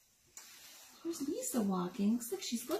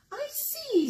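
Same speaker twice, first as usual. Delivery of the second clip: mama pig; 14 semitones higher than usual. Acted. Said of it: "said the mama pig".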